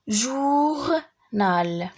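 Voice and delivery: "جورنال" as long jour, pause, nal; "جورنال" syllable by syllable; split into syllables. Shown as a demonstration. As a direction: stretch "جورنال" slowly over two parts. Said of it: "لي سيلاب اللي فيهم او".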